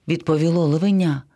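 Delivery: ordinary delivery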